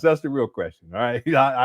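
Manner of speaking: usual speaking voice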